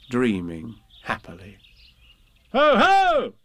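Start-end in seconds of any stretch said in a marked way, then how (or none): 2.5-3.3 high, booming storybook call; sudden high hail; loud and sudden. Acted. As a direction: burst out loudly to startle. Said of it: "said Christopher Robin loudly and suddenly".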